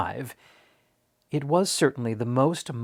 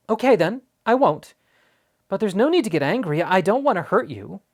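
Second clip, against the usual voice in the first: put-on reassuring boy; 7 semitones higher, 4 dB louder than usual. Boy backs down, soothes.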